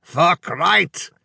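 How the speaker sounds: put-on high, emphatic accented exclamation; loud Hungarian agreement. A narrator's reading. Drunk Hungarian agreeing with the restaurant owner, loud. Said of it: "the Hungarian said loudly".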